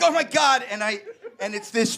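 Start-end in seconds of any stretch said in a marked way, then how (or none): 0.0-0.6 freaked out. Retold or imitated. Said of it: "And I'm like".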